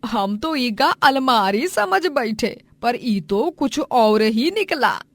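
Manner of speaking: drawn-out rural lilt; village elder, taken aback. Acted. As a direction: puzzle aloud over the mistake.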